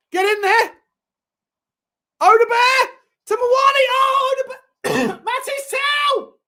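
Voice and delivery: high loud breathless commentary; frantic excited calling. Real, not acted. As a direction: call the attack like it matters most. Live excited fan commentary.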